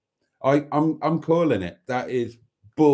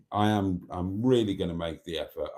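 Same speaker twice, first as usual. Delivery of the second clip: ordinary talk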